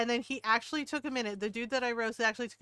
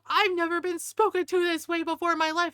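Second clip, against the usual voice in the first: higher, louder mock indignation; indignant retort; 6 semitones higher, 6 dB louder than usual. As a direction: imitate an offended customer, raised pitch. Offended customer retold, indignant protest.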